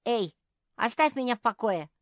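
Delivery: high, loud, quick demand. A narrator's reading. Squirrel to the friend shaking him, loud demand. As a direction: demand it loudly and fast. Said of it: "потребовал Бельчонок".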